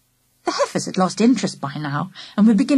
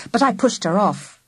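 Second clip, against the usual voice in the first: plain reading voice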